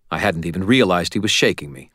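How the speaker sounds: ordinary delivery, nothing marked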